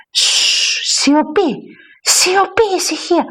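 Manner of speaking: long hiss, then high-pitched call for silence; insistent church shushing. Acted. Someone shushes the quarrelling women.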